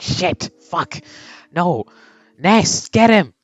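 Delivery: high, loud frantic yelling; frantic shout at Ness; panicked yell. Acted. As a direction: scream at a teammate to stop him.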